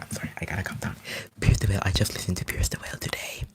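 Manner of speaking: ordinary talk, nothing marked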